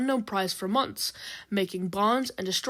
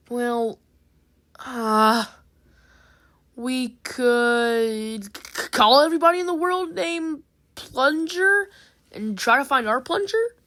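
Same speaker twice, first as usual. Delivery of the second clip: reluctant; 5 semitones higher, 6 dB louder, slower than usual. A narrator's reading. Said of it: "replied reluctantly".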